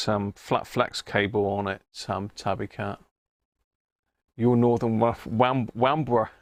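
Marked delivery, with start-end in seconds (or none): 4.4-6.3 "Wambler" with a W where the R of "Rambler" belongs; stumbling, "Waf-, Wam-, Wambler" with W for R; says w for r. Real, not acted. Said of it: "God, I can't pronounce my R's today".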